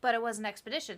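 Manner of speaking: ordinary talk, nothing marked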